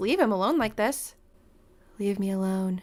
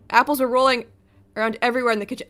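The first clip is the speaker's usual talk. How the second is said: ordinary delivery, nothing marked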